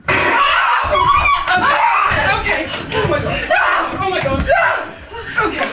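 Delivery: high frantic cries; panicked. Acted. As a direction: panic after the shots. Panic after gunfire.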